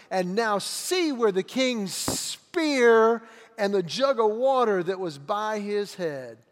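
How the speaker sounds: loud, slow, accusing proclamation; fiery scripture reading. Acted. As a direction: thunder the rebuke across the valley. Reads David's rebuke of Abner.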